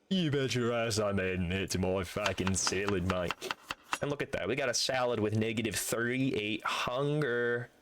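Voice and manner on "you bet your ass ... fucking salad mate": a hint of a put-on Australian accent; mock Australian accent. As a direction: play a cocky Aussie bloke, lightly. Aussie bit continues.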